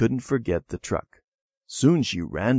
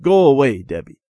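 loud, cross order; angry dismissal; 5 semitones higher, 10 dB louder than usual. A narrator's reading. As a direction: send a sister away angrily. Cross brother sends sister off.

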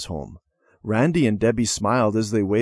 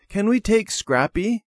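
put-on little girl voice; 10 semitones higher than usual. Child asks to bring dog.

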